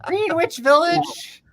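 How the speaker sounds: put-on high, slow old lady saying "Greenwich" gren-itch. Retelling the old ladies of South Philly, high old lady voice. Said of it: "You mean Greenwich".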